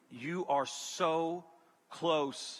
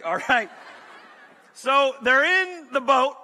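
ordinary talk

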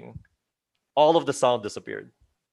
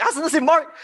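high panicked cry through a laugh, louder; panicked laughing question; 5 dB louder than usual. Retold or imitated. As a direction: ask in laughing panic where he went. Realizing friend missing, panicking.

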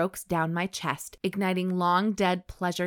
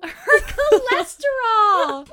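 shrieking high, loud, slow through laughter; helpless laughter; 10 dB louder, slower than usual. Real, not acted. Reader repeats co-host's cholesterol joke, helpless high laughing. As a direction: crack up while repeating the words.

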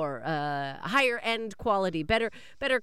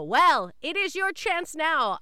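salesy pitch; 8 semitones higher, 5 dB louder, slower than usual. Acted.

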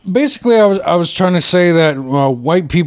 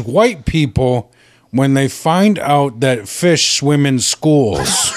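slow meme read; 4 semitones lower, much slower than usual. Retold or imitated. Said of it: "And it says".

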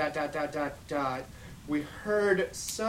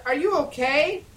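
worried cry; 10 semitones higher, 6 dB louder than usual. Acted. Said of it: "I cried".

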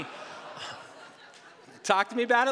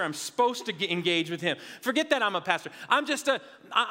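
normal talking voice

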